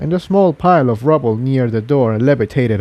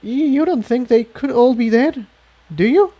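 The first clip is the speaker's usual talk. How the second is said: high, stammering worried pony voice; frightened filly; 13 semitones higher than usual. Acted. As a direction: dread it in a small high voice. Tiptoe fears everyone dead.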